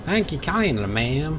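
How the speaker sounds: put-on awful Deep South accent. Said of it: "my horrendous attempt at a deep south accent".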